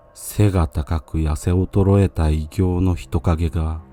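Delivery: plain reading voice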